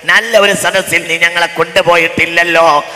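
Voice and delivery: fiery Malayalam sermon voice; preaching at full force. Acted.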